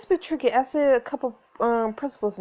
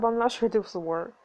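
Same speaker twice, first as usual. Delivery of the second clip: usual speaking voice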